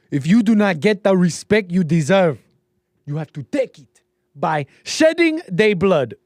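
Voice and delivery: put-on loud, high African-accented movie speech; mock African accent. Host quoting Blood Diamond, loud mock African accent. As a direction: declaim it like a warlord in an African accent. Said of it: "can do an accent".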